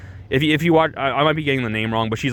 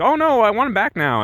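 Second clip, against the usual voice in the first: whiny mock voice; 11 semitones higher than usual. Carmela whining.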